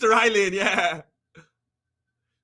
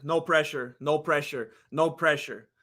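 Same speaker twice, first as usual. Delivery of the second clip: soft reassuring; 7 semitones lower, 5 dB quieter than usual. Real, not acted.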